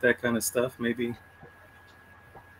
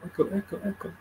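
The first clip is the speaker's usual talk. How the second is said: fading echo, each repeat softer; 7 semitones higher, 9 dB quieter, faster than usual. Shown as a demonstration. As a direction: repeat it like a voice dying away in a cave. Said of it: "You're echoing".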